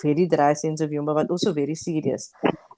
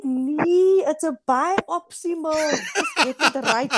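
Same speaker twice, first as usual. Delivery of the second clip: high, amused exasperation with a laugh creeping in; retold daughterly exasperation; exasperated correction; 8 semitones higher, slower than usual. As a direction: correct mom in a much higher voice, half laughing. Retelling her correction of mother's mistake.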